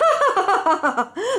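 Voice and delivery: high, hearty guffaw; sultan's belly laugh. Acted. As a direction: fall over laughing. Le sultan rit. (grand éclat de rire aigu)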